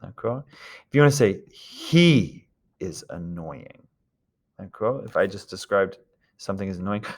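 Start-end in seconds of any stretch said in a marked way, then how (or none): 1.5-3.9 "He" drawn out, very slow phrase; stress on He. Shown as a demonstration. Teacher showing emphasis on him, long stressed "He". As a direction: stretch and lean on "He". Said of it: "to emphasize that you're talking about him".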